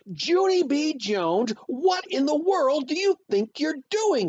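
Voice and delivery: high, loud scolding mother; shrieked scolding, full name first; angry holler. A narrator's reading. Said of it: "she hollered".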